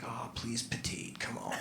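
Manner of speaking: hushed, desperate little plea under the breath; barely voiced begging to himself; pleading whisper. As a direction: whisper a prayer that someone small sits here. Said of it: "and I'm just like".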